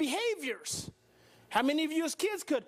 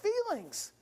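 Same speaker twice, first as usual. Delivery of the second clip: ordinary delivery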